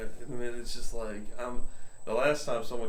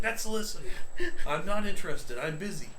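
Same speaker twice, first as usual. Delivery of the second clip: exasperated retold rebuff; 7 semitones higher than usual.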